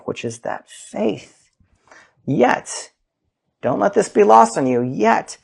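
ordinary talk

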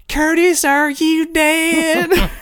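very high, loud, drawn-out Southern whine; Connie. Retold or imitated. Imitating a dim movie character calling Curtis, thick whiny Southern accent. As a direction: screech it slowly in a super thick Southern accent. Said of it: "a super thick Southern accent".

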